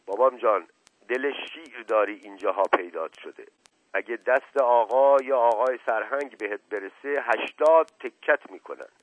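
plain reading voice

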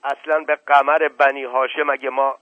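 suddenly indignant, loud outburst; flaring up. Acted. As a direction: explode in offended protest. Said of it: "ناگهان برآشفت".

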